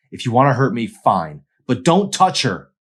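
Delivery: loud, high, desperate plea; desperate shout. Acted. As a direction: shout to protect her, slowing at the end. Arson pleading with the bullies.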